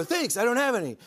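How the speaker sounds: high-pitched host impression; Jimmy. Comedian retelling the host's reply to his joke, high impression. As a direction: imitate the host's quick, cheerful comeback. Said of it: "And you go".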